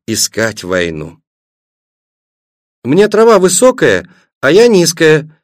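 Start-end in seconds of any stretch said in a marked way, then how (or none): 2.8-5.4 put-on high-pitched little-girl grumble; childish complaint. A narrator's reading. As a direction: complain plaintively in a raised child's voice. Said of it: "пожаловалась Светлана". (детская жалоба)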